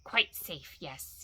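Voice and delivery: soft, hissed creature whisper; hushed sibilant self-talk; whispered to himself. A narrator's reading. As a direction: whisper it privately, savouring the hiss. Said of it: "he whispered to himself".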